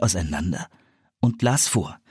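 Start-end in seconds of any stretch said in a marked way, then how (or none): none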